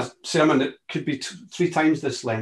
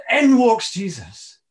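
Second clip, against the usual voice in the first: louder and higher, slowed for effect; dramatic announcement; 5 semitones higher, 6 dB louder, slower than usual. Acted. Preacher announcing Jesus walking into the locked room, dramatic. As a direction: announce his arrival with awe. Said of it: "dramatic entrance line".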